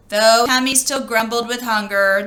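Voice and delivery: ordinary delivery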